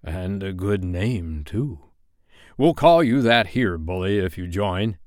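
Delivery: slow, drawn-out pirate captain; Captain Hook, approving. Acted. Hook recruiting John to the crew, slow pirate drawl. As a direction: lure them in as a pirate chief.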